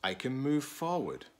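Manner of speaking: expressive picture-book read-aloud; reading to children. Acted. Teacher reading a picture book to students, expressive read-aloud. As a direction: read it slowly to a class of children.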